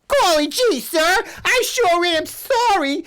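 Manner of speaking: put-on very high, slow sweet kid voice; squeaky innocent little girl; wholesome 1950s kid. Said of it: "she's like".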